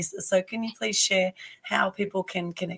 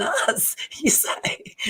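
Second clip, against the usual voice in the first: laughing whisper; 8 semitones higher, much slower than usual. Real, not acted. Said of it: "laughing mid-sentence".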